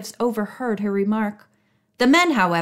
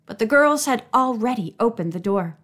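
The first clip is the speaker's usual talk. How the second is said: ordinary delivery, nothing marked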